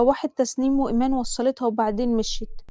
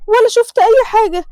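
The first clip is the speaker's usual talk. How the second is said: higher, louder frightened cry; fearful outburst; 9 semitones higher, 7 dB louder than usual. Acted. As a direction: swear you saw nothing, scared. Frightened vow of silence.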